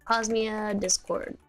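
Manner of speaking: usual speaking voice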